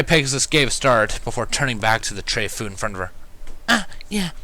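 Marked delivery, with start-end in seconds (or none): 3.7-4.3 high, hurried shy apology; flustered shy mare, higher; a startled shy mare apologizing, high and hurried; rapid murmur. Acted. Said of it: "she rapidly murmured".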